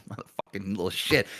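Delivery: low, soft grumble under the breath; hushed, low mock grumbling; muttered cursing. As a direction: curse the kid quietly to yourself, acting it out. Retold grumble about the kid's demand.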